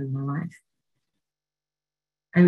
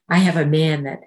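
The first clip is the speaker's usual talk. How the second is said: ordinary talk, nothing marked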